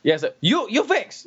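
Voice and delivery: high mock Chinese-accented English. Mandarin-speaking customer orders decaf, in a high mock Chinese accent. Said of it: "I was like".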